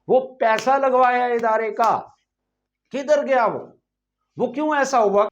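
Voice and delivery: indignant rant. Acted. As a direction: demand answers, pitched well above normal talk. Indignant questioning before the greeting. (اونچی آواز میں برہم بازپرس)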